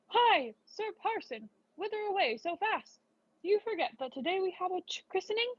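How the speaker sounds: sexton calling out. Acted. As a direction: hail the parson in a high surprised voice. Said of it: "he called out".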